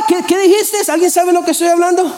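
high, puzzled call across a noisy crowd; a baffled listener who can't hear a thing; confused crowd member. Acted. Gente en la multitud que no oye. (persona confundida en la multitud: aguda, perpleja)

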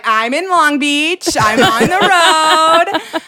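loud, high, stretched-out announcing; mom impression. Mom reporting where she is to daughter, loud drawn-out mom voice. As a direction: draw each phrase way out as mom. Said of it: "she's like".

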